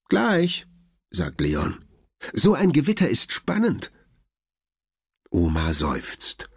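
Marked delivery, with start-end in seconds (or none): 0.1-0.7 put-on high, eager little boy; boy's voice. Narrator voices Leon. 2.3-3.9 put-on high, eager little boy; boy's voice. Narrator voices Leon.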